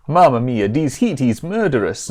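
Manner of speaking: hammed-up Italian accent. Pizza elemental complains to the traveller, in a mock Italian accent. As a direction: put on a rough, apologetic Italian accent. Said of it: "they've done an Italian".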